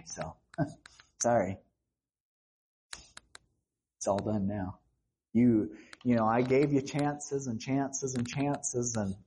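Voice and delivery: low, fast offhand brush-off; mock dismissive god. Preacher mimics a careless God dismissing a sinner, low and offhand. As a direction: shrug them off, bored and casual.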